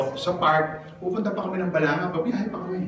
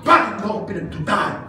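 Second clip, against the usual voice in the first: scolding; 6 dB louder, faster than usual. Shown as a demonstration. Preacher voices Jesus scolding.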